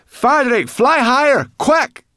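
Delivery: high-pitched shouted command; loud, panicky order to climb; urgent calling. A narrator's reading. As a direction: yell an urgent warning. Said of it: "she called".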